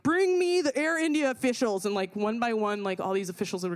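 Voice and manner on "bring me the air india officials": mock crowd demand. Angry passengers demanding officials, high mock yell. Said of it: "the people of Gate nineteen are like".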